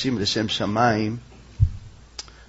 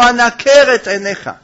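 loud, high-pitched menace; acted threat; 8 semitones higher, 7 dB louder than usual. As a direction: threaten him at full voice, as the tempting woman. Woman threatens Joseph, acted quarrel.

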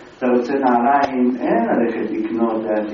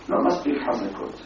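softly voiced retold grumble; understated complaint; 8 dB quieter, faster than usual. Customer tells shoemaker about his shoes, understated complaint. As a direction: let the complaint drop away softly.

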